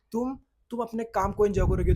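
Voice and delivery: ordinary talk, nothing marked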